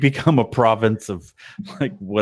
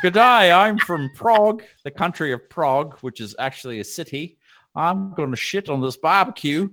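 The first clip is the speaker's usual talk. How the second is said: high, hammed-up Australian accent; 6 semitones higher than usual. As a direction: put on a comic Aussie accent, pitched up. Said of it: "It always ends up Australian".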